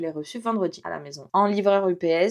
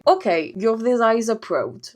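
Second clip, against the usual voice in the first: put-on American accent; 4 semitones higher, 3 dB louder than usual. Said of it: "il fait".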